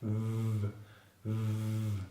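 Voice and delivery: "V" as a soft, sustained buzzing consonant; long vibrating V. Shown as a demonstration. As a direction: hold the bare "V" sound, buzzing on the lip. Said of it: "a little bit longer and with lots of vibration".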